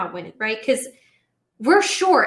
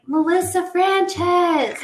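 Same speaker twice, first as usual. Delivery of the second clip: put-on fake name; 7 semitones higher, 3 dB louder, much slower than usual. Fake name to the party girl.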